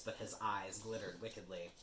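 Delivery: plain reading voice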